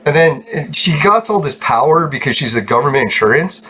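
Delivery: ordinary talk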